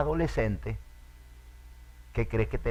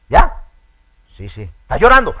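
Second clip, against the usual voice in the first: higher, louder mock concern; loud teasing; 7 semitones higher, 16 dB louder than usual. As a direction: tease the one who cries. Teasing someone crying. (burla en voz alta)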